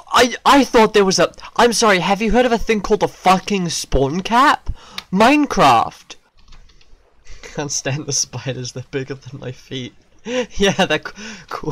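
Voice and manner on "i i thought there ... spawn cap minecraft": fast, high, loud complaint; indignant rant. Real, not acted. Player scolding Minecraft about spawn cap, indignant. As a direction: scold in quick, raised-pitch indignation.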